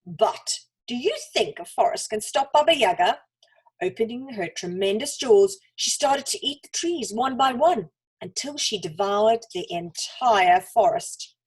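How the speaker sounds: plain reading voice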